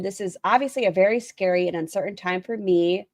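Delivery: ordinary talk, nothing marked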